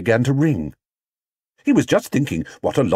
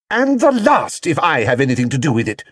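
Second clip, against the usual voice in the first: firm mother; 6 semitones higher, 4 dB louder than usual. Acted. Mrs Brown firmly intervenes.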